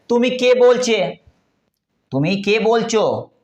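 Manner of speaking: Bengali-style tumi ke bolcho with rounded o; Bengali pronunciation. Shown as a demonstration. Teacher demonstrating Bengali phrase to students, Bengali accent. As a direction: say it the way a Bengali speaker would. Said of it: "इसी को बंगला में देखिए कैसे".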